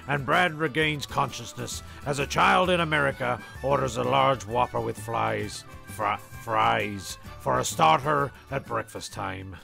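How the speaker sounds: ordinary talk, nothing marked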